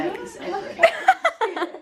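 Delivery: bright high-pitched giggle; high laugh. Real, not acted. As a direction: burst into a squealing laugh. Laughs at all being home.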